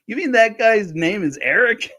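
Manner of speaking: like he just learned the name; incredulous surprise. Retold or imitated. Lloyd learning Marty's real name, high and incredulous. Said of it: "he's like".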